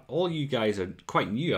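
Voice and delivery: ordinary talk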